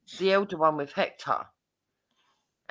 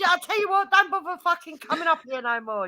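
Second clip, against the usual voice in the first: high, mock-indignant retort; retold retort; 10 semitones higher than usual. Husband telling wife off, high mock indignation. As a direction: snap it back at her.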